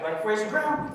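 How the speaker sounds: ordinary talk, nothing marked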